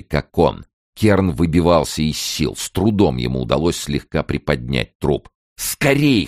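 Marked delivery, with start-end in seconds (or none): 5.6-6.3 high, strained urgent call; hoarse urging. A narrator's reading. Kern holding up the body, straining hoarsely. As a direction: urge him on under heavy strain. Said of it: "хрипел он".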